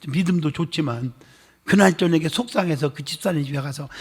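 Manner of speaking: normal talking voice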